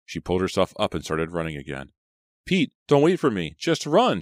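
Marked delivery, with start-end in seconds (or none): 2.5-4.2 high, loud shout to run on; frantic call to Pete; urgent call. A narrator's reading. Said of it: "she called".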